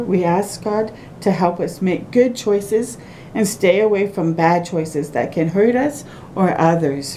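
plain reading voice

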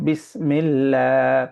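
বিসমিল্লাহ with a thin, light lam, mouth open, slowly drawn; thin lam recitation. Shown as a demonstration. Teacher showing the thin lam in বিসমিল্লাহ, slow recitation. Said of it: "ফাঁকা করে পড়ব".

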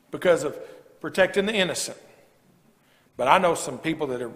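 usual speaking voice

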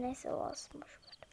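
unvoiced whispered give-up; resigned whisper under the breath. Real, not acted. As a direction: breathe it out to yourself, letting it go. Muttering to himself, giving up.

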